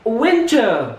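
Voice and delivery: British winter, the R dropped; British accent. Shown as a demonstration. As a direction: say winter the UK way, no R at the end. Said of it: "kalau dalam bentuk U K".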